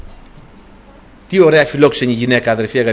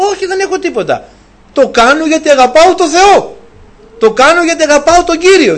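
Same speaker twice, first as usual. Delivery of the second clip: devout woman's answer; 10 semitones higher, 6 dB louder than usual. Acted. Shunammite woman refusing reward, high fervent voice.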